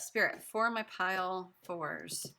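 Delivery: slow, drawn-out call to Spirit; slow invocation. Acted. Reader asking Spirit about pile four, slow invocation. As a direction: stretch each word as you call on Spirit.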